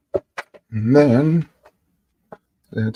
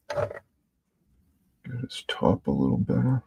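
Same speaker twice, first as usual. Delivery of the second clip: soft unvoiced whisper; 7 dB quieter than usual. Real, not acted. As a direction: murmur it while working. Murmured to self while painting.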